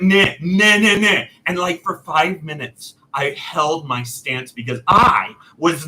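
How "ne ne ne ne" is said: loud, firm ne ne; stubborn refusal. Retold or imitated. Customer refusing the clerk, loud and firm. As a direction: dig in and refuse. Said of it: "And I was like".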